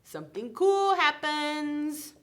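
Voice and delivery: storyteller revealing the happy twist; excited reveal. Acted. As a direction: announce it with wonder.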